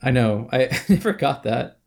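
usual speaking voice

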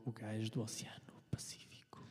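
soft whispered voice-over. Acted. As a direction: breathe it like an ad narrator. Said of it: "Parece o". (sussurro sensual)

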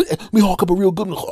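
high, loud, fast scheming voices; conspiring siblings. Retold or imitated. Siblings plotting in the kitchen, high loud glee. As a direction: siblings egging each other on, loud and high. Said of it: "people going".

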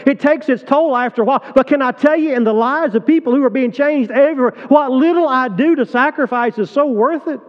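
high, loud sermon push; preaching at full force. Real, not acted. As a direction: drive it home to the pews. Preaching his own sacrifice.

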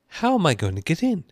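put-on high, loud girl's question; insistent little Alice, pitched well up; pleading Alice. A narrator's reading. Alice asks unhelpful footman for entry.

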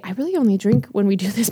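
ordinary delivery

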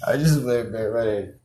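normal talking voice

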